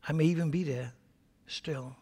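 hushed pastoral prayer; closing prayer. Acted. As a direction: pray quietly and earnestly. Leads a closing prayer over music.

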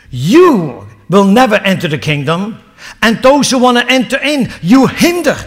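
loud, high accusation building to "hinder"; fierce rebuke. Acted. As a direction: rebuke the leaders with force. Jesus rebuking the leaders.

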